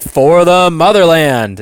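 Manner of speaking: battle cry. Retold or imitated. Opponent's battle cry, acted in the retelling.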